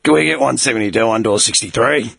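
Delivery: low put-on radio command; deep authoritative voice. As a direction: call the yard like you're in charge. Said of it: "deep authoritative voice".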